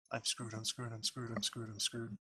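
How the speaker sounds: softly repeated panicked mutter; under your breath, panicking; muttered aside. Real, not acted.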